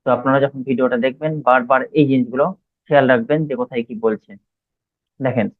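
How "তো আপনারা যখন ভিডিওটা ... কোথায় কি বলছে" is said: normal talking voice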